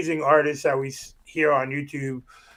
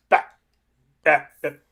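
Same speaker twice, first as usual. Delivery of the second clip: glitchy stutter; 4 dB louder than usual. Retold or imitated. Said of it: "only caught, like".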